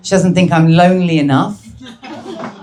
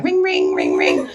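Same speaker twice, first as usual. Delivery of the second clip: doorbell imitation; 12 semitones lower, 4 dB quieter than usual.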